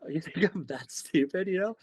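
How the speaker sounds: mock indignant. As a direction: play the offended local.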